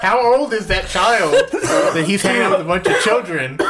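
high, incredulous ranting through laughter; laughing disbelief. Real, not acted. Telling co-hosts about a bald child in the film, laughing disbelief.

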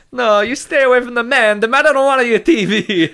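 a loud, high, cartoon Italian-American mamma; Italian-American accent. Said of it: "I can do this accent forever".

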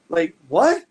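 incredulous. Real, not acted.

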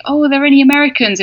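lightly voiced host calling out to the audience; theater host. Theater host asking a cruise audience for Americans, lightly imitated announcer. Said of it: "in the theater they'd say".

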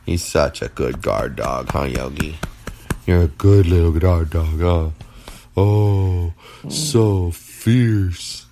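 sweet doggy voice; pet talk. Real, not acted. Baby talk to dog.

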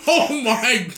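high, loud, helpless real laughter; can barely get the words out for laughing; laughing hard. Real, not acted. Host reacting to the joke going too far, laughing helplessly.

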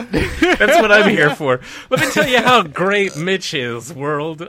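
loud, laughing hype man pitch. Pretend. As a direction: sell Mitch to the world, loud. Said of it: "hire a hype man, dude".

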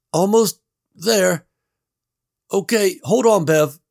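Gary calling through the wall; Gary's voice. A narrator's reading.

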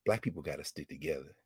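hushed, low close-to-the-ear murmur; quiet confiding line; soft quote. Retold or imitated. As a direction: say it softly into her ear. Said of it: "then she said in my ear".

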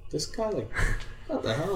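surprised. Retold or imitated. Retelling his shocked reaction to coach.

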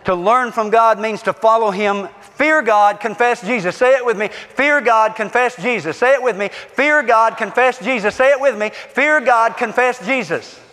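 emphatic rallying preacher; call-and-response preaching. Real, not acted. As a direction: drive the congregation to say it with you. Leads congregation in repeated refrain.

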